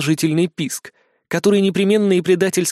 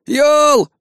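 sudden high yell; yelling a name; 11 semitones higher, 5 dB louder than usual. Acted. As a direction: shout the name across the hall. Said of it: "вдруг заорал Скиф".

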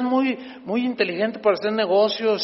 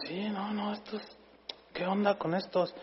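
hushed aside; 2 semitones higher, 11 dB quieter than usual. Retold or imitated. Own quoted reply, hushed.

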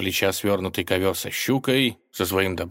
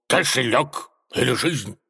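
menacing demand, pitched up; threatening; 5 semitones higher than usual. A narrator's reading. Robber advancing on the tsar, threatening. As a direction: threaten him like a storybook bandit. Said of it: "угрожающе двинулся на него разбойник".

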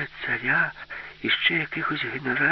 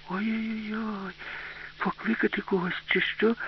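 put-on soft, high girlish fretting; drawn-out, hushed worried girl; 10 semitones higher, 6 dB quieter than usual. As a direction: fret anxiously in a small high voice. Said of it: "забідкалась Аліса".

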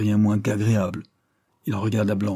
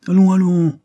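high, loud sustained call; impatient exclamation; 10 semitones higher, 10 dB louder than usual. A narrator's reading. Delmare rises from the table, loudly impatient. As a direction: break off the quarrel forcefully.

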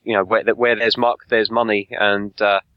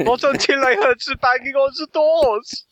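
German villain voice; 13 semitones higher than usual. Acted. S1 acts out Dotcom's story.

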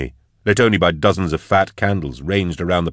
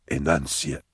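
hoarse; 4 semitones lower, 8 dB quieter than usual. Acted. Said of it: "said a hoarse voice".

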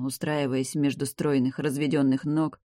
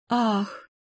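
gasp; 8 semitones higher than usual. A narrator's reading. Heroine gasps in pleasure.

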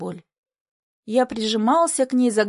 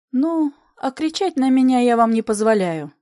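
plain reading voice

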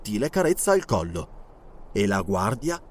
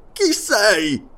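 alarmed guard challenge; 7 semitones higher, 4 dB louder than usual. Acted. Guard repeats challenge, spear raised.

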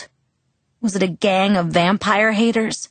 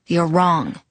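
ordinary delivery

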